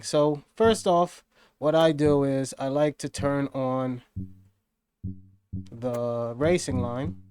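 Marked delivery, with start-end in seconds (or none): none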